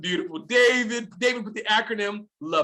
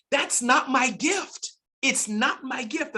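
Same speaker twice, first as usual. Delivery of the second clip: slow and sincere; 5 semitones higher, slower than usual. Real, not acted. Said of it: "slow emphatic self-admission".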